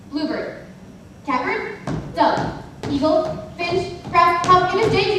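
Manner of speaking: ordinary talk, nothing marked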